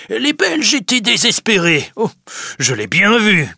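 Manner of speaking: high, loud, gleeful boasting; very pleased. A narrator's reading. Grandet tells his wife the wine is sold, gleeful and loud. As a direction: crow over the good deal. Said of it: "Il semble très content".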